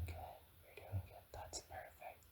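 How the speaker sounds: very soft, near-whispered encouragement; hushed reassurance. Pretend. Dream guide tells the dragon they're doing good, hushed reassurance. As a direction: drop almost to a whisper to reassure.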